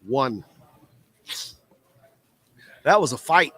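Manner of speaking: ordinary delivery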